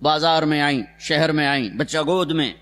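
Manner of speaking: ordinary talk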